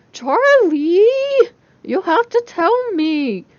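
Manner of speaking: high, loud, slow whiny plea; exaggerated moan. A narrator's reading. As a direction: ham up the begging. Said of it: "Jessica moaned exaggeratedly".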